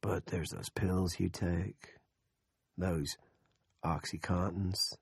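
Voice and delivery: soft, slow, threatening murmur; menacing murmur. A narrator's reading. Big Jim threatening Andrea, soft and slow. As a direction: drop the voice to a quiet threat. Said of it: "dropped to a murmur".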